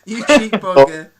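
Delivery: loud, slow, teasing jab; mock insult. Real, not acted. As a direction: rib a mate with a loud laughing insult. Teasing friend about bad internet.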